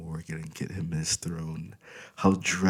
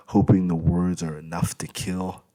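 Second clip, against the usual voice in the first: plain reading voice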